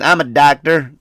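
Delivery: high, loud Southern accent imitation; mock Southern doctor. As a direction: put on a thick Southern accent. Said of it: "he sounded just like that".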